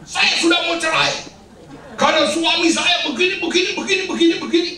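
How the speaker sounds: high-pitched mock complaint; nagging wife. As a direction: imitate a chattering wife rattling off grievances. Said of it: "si istri cerewet".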